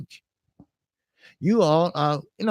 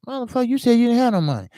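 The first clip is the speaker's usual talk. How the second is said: higher, fast exasperated jab at his buddy; annoyed at friend; 7 semitones higher, much faster than usual. Retold or imitated. To his lying friend about the money, fast and exasperated. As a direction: spit it out quick, caught him lying. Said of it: "I'm like".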